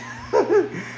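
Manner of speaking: high airy laugh; quick laugh, short of breath; breathless laugh. Real, not acted. Laughs while winded.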